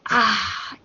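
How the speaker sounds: ordinary talk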